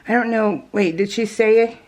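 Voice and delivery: usual speaking voice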